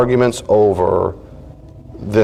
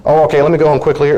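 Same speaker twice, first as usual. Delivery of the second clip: ordinary talk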